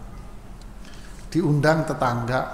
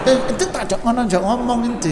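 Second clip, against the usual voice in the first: higher-pitched, brisk East Javanese scolding; blunt East Javanese; 7 semitones higher, faster than usual. Acted. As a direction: play a brusque East Javanese speaker. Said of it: "Jawa Timur". (gaya orang Jawa Timur menegur)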